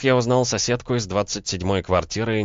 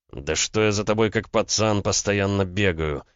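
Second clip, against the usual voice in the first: plain reading voice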